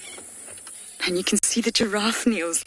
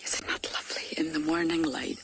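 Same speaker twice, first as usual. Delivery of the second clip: whispered and much softer, not to disturb the cats; hushed whisper; 3 semitones lower, 6 dB quieter than usual. Real, not acted. Guide admiring the leopards in morning light, whispered. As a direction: breathe it out in a real whisper.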